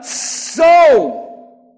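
SO drawn out long and loud; stress on SO. Acted. God promising Hagar great increase, SO heavily stressed. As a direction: hit "so" hard and stretch it. Preacher emphasises a word of promise.

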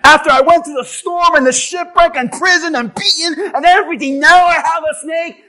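loud, high, indignant protest; acted-out angry tirade. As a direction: rail at God, fed up and incredulous. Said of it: "Some of you would be angry".

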